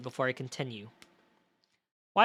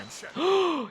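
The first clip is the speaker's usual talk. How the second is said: excited gasp; 13 semitones higher than usual. Real, not acted. Prosecutor takes his side.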